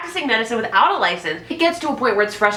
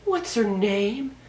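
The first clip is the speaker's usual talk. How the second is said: mock Robin; 5 dB quieter, much slower than usual. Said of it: "And then Robin's like".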